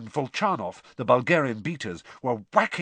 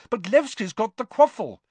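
high, loud protest; yell; 9 semitones higher, 5 dB louder than usual. A narrator's reading. Boy protests over the match noise, yelling. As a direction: call it out in confusion. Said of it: "Harry yelled".

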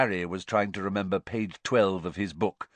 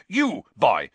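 snarled; 4 semitones higher, 6 dB louder than usual. A narrator's reading. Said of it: "he snarled at Harry".

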